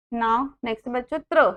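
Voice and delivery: ordinary talk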